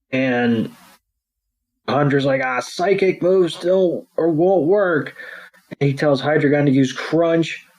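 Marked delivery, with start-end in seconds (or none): 2.4-5.2 mock villain voice.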